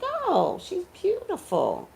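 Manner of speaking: high, airy "oh, she's beautiful"; admiring gasp. Retold or imitated. Admiring another woman's looks, high and breathy. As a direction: gush over another woman as if struck. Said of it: "I'm like".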